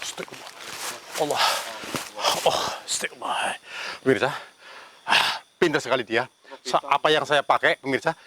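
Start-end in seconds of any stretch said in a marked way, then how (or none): 0.0-3.6 breathless whispered astagfirullah; whispered prayer. Real, not acted. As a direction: whisper it in fear. (bisikan doa ketakutan)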